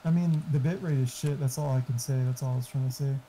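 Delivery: normal talking voice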